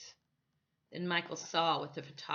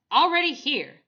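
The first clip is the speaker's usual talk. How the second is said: higher, louder second already here; louder repeat; 8 semitones higher, 11 dB louder than usual. A narrator's reading. Photographer hurrying the boys, louder and higher. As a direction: insist the second time.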